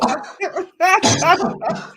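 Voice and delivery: high wheezing cackle; wheezing laugh. Real, not acted. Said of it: "I'm wheezing".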